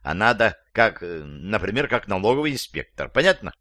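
usual speaking voice

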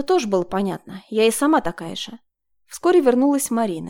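ordinary delivery, nothing marked

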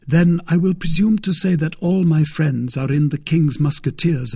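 lightly voiced young guardsman, politely declining; D'Artagnan, deferential. A narrator's reading.

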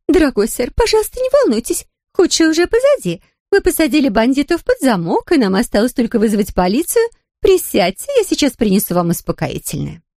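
high, soothing and kind; calm the frightened old man; reassuring housekeeper. A narrator's reading. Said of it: "постаралась успокоить его". (успокаивающе, мягко)

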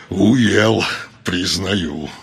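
low, slow elf echoing words; dark elf echoing. A narrator's reading. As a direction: echo the words low and dry. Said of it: "в точности повторил слова Черноцвета".

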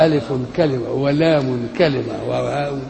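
slow "ألف كلمة", each letter name ending in -un; separate words with tanween. Shown as a demonstration. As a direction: count out "ولام" as its own word, slowly, with tanween. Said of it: "ولكن إيه". (ببطء، "ألف" و"لام" منوّنتان كلمة كلمة)

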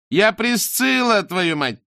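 angry yell. A narrator's reading. Daughter yells back.

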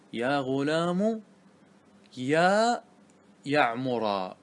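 يعمر said with a drawn-out final a, يا غلام يا يعمرا; wrong case ending. Shown as a demonstration. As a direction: wrongly end يعمر in a, slowly. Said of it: "وهذا لا يجوز". (نطق خاطئ بنصب يعمر)

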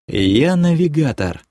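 wistful, understated confession; melancholy. A narrator's reading. Man confessing his main secret to his girlfriend, melancholy. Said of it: "меланхолично поведал я".

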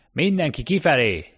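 loud, high-pitched order; foreman's shout. A narrator's reading. Foreman ordering workers out of the lift, shouting. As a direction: call the whole crew out. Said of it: "kiabált a munkavezető".

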